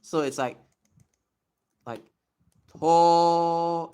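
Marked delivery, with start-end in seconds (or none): 2.8-3.9 to held long, louder and higher; stretched to. Shown as a demonstration. Showing viewers the stretch, long loud to. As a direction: hold the to sound out. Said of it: "a stretch sound".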